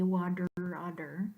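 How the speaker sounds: water, otter with the T as a quick D tap; flapped American T. Shown as a demonstration. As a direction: say water, otter as wadder, odder. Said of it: "They're all D".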